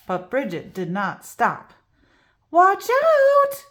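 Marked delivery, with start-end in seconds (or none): 2.5-3.6 loud, high warning shout; alarmed cry. Acted. Teacher warning the class, loud high shout. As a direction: warn the class sharply. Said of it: "cried Miz Pickles".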